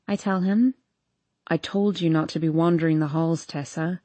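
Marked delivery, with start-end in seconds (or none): 1.5-4.0 low, restrained male scold; mildly reproving in a lowered voice. A narrator's reading. Boyfriend scolding her for wandering the halls, low and stern. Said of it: "he scolds".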